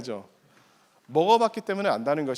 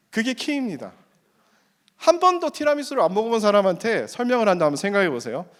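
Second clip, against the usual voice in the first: ordinary talk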